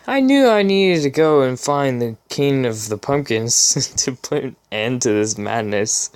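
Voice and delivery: normal talking voice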